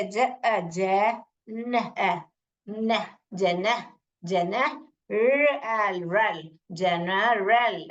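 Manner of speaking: जनरल built slowly, ज then न then रल; broken into sounds. Shown as a demonstration. Teacher shows children how to read, piece-by-piece जनरल. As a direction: separate each sound, then blend into a drawn-out जनरल. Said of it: "ऐसे तोड़ तोड़ के पढ़ेंगे".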